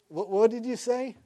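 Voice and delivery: high, stammered astonished question; acted startled question. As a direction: repeat the first word as if taken aback. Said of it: "he says".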